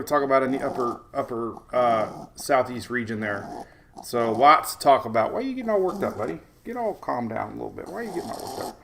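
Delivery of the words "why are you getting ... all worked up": softly hushing a fussy little one. Real, not acted. Soothing his fussing baby.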